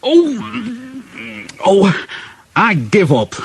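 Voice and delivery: high strained groans, dropping into defeat; worn-out exasperation starting high; exasperated driver. A narrator's reading. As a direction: groan with effort, then give up. Driver straining, then giving up.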